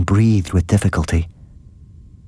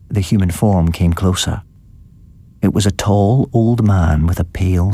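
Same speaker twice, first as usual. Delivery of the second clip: ordinary delivery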